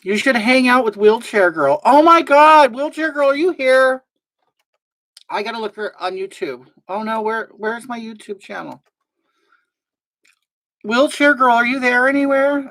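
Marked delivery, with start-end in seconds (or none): 1.8-4.0 high, loud call to someone out there; excited calling out. Real, not acted. Streamer hailing Wheelchair Girl in the audience, excited calling out. 10.8-12.7 high-pitched searching call. Real, not acted. Streamer searching for Wheelchair Girl, calling out.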